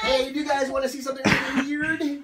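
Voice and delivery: high, loud show host hype; excited showman. Acted. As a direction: build up the next trick. Magician hypes next trick.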